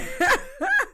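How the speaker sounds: very high short laugh; quick high-pitched titter; real giggle. Real, not acted. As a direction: let out a squeaky giggle. Laughs at own remark.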